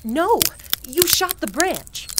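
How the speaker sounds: high, loud alarmed yell; shocked shout. Acted. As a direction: cry out in alarm. Shocked at shot branch.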